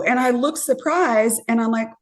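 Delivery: ordinary talk, nothing marked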